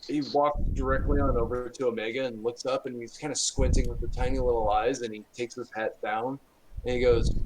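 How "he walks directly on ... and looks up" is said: ordinary delivery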